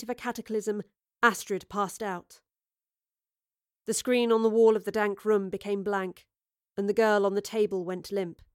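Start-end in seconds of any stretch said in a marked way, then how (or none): none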